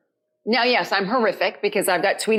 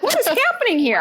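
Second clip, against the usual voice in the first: high, laughing disbelief; baffled by the restraints; bewildered; 10 semitones higher than usual. Retold or imitated. Strapped to the board, high laughing bewilderment. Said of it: "I'm like".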